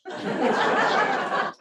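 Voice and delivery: a bright burst of real laughter. Real, not acted. Telling the mugging story to students, bursts into a real laugh.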